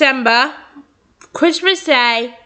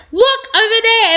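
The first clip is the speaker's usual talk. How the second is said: high yelled call; yelling; 10 semitones higher than usual. Acted. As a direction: call it out to the others. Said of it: "Anastasia yelling".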